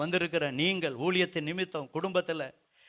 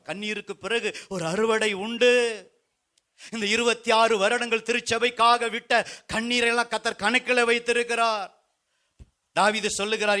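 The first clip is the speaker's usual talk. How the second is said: raised, insistent pulpit cry; full-force preaching; 6 semitones higher, 7 dB louder than usual. Real, not acted. Preacher tells brothers and sisters God wipes tears, full-force preaching. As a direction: drive each appeal home to the whole hall.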